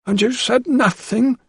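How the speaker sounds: high, slow, protesting woman's voice; a flustered housekeeper objecting. A narrator's reading. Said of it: "she protested".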